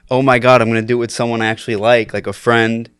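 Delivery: normal talking voice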